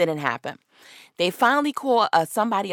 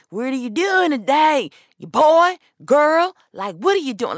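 aggressive cop; 7 semitones higher, 6 dB louder than usual. Retold or imitated. Imagined aggressive officer confronting her.

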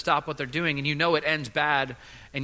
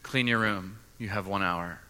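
stern dad; 7 semitones lower, 5 dB quieter than usual. Retold or imitated. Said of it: "And I say".

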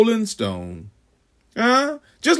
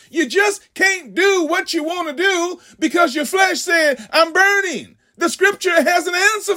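preaching shout; 7 semitones higher than usual. Acted. Preacher raising voice.

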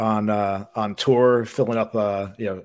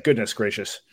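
usual speaking voice

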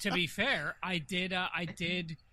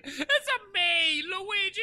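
loud, high-pitched cartoon Italian voice; hammed-up game character, squealed through laughter; Luigi; 8 dB louder, slower than usual. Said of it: "He's all like".

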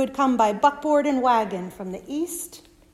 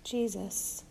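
soft; 3 semitones lower, 10 dB quieter than usual. Shown as a demonstration. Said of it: "repeated refrain".

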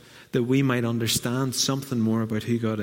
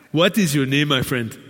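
imitated African accent, louder opening; 3 semitones higher, 4 dB louder than usual. Man greets a newcomer in a Ghanaian church, African accent. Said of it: "I'll start with the African accent".

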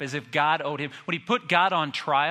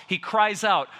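normal talking voice

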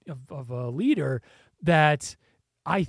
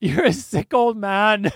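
louder, slow, drawn-out mock heckle; imitated heckle; 4 semitones higher, 6 dB louder, slower than usual. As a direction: mimic a crowd heckler, stretching the words.

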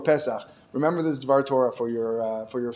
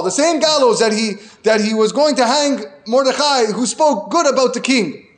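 preaching at full force; 3 semitones higher, 4 dB louder than usual. Acted. Rabbi urging listeners to hold back their words, loud preaching. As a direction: drive the lesson home to the congregation.

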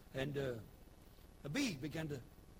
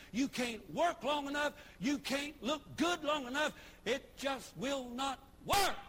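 higher, louder hammering preaching; full-force preaching; 10 semitones higher, 7 dB louder than usual. Acted. Preacher warning the congregation, preaching hard. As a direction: drive each line home from the pulpit.

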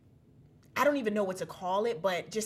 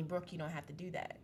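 soft, low-key inner voice; hushed, gentle telling; small voice; 4 semitones lower, 12 dB quieter than usual. Retold or imitated. Small voice urging Brooke to hold back, quietly. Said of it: "it was so small".

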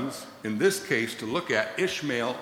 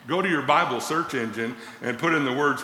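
ordinary talk

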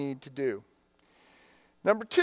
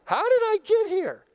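much higher, louder anguished wail; wailing cry; 4 dB louder than usual. Retold or imitated. Pastor voicing the despairing question, a high anguished wail. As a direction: cry it out like you've hit bottom. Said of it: "cry and say".

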